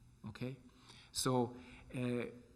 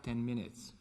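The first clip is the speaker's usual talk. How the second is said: ordinary delivery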